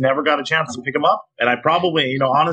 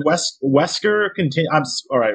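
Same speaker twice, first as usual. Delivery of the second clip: normal talking voice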